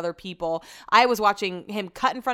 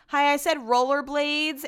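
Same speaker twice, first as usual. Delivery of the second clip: pointed repeat; 7 semitones higher, 3 dB louder, slower than usual. Retold or imitated. Annoyed retort at rental counter.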